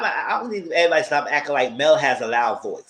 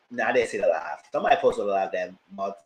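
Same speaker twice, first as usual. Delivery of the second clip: usual speaking voice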